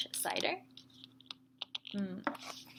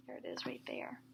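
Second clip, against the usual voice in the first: hushed and lowered, almost breathless; spooky whisper; 19 dB quieter, faster than usual. Acted. Teacher showing kids the scary scarecrow, spooky whisper. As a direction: whisper it like something scary is near.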